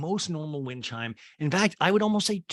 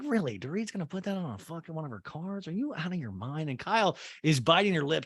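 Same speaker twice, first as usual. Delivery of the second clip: ordinary talk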